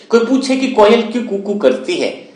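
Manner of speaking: ordinary talk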